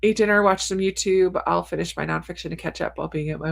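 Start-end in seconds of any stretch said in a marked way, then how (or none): none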